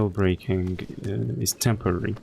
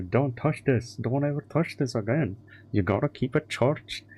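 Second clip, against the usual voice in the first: mock Indian tech-guy accent, pitched up; imitated Indian accent; 4 semitones higher than usual. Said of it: "they didn't have Indian accent".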